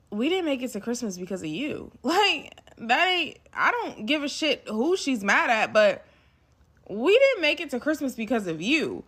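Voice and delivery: high-pitched, indignant comeback; exasperated. Retold or imitated. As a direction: throw the blame straight back at him. Retold argument, throwing blame back.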